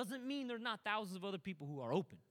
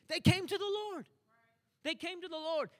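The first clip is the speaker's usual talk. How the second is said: much higher and louder, then easing; triumphant preaching; 8 semitones higher, 6 dB louder than usual. Acted. Preacher announcing the Samaritans came to the Lord, triumphant. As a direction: proclaim it like a victory.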